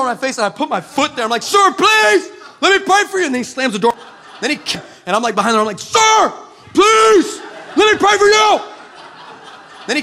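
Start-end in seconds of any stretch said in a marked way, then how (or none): 1.4-3.3 high, shouted begging; desperate plea. Acted. Preacher with foot in the door, pleading desperately. 5.8-8.6 very high, loud yelling; desperate shouted plea. Acted. Preacher shouting from behind the door, desperate.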